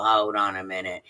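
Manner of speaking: ordinary talk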